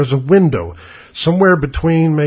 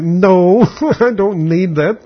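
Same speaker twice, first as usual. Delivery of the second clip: loud, high no breaking into a laugh; mock-incredulous refusal, pitched up; 5 semitones higher, 3 dB louder, much slower than usual. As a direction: laugh it off emphatically. Laughingly refusing absurd barber offer.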